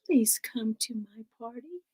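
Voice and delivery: soft whispered plea; little girl whispering. Acted. Little girl invites the witch in a whisper.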